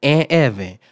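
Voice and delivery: loud, emphatic name call. Retold or imitated. Assistant principal summoning second suspect, calling out. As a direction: announce the second name loudly to the room. Official calls out the narrator.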